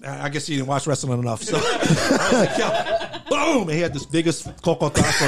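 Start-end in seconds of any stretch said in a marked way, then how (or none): 2.6-3.6 mock impact shout. Imitating DDT impact.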